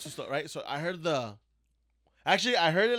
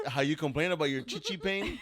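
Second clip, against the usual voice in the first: usual speaking voice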